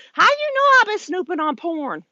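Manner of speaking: high, squeaky mock husband, busted and defensive; caught husband. Husbands caught by their wife, voiced high and defensive. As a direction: play the guilty husband caught out, high and indignant. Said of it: "They're like".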